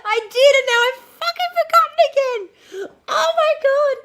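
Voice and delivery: high, loud laugh-crying wail. Real, not acted. To Kim after forgetting again, laugh-crying. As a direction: wail through laughter in mock despair. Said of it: "I'm so annoyed".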